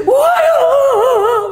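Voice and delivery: high, drawn-out sobbing call; crying out. Retold or imitated. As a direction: wail the daughter's name. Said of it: "울면서".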